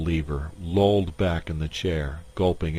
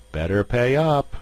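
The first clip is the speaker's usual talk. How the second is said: calling out; 6 semitones higher, 5 dB louder than usual. Acted. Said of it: "somebody called".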